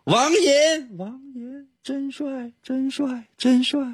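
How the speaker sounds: fading repeated echo; canyon echo. Pretend. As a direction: call out and let each repeat die away. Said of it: "这回声好好啊". (山谷回声)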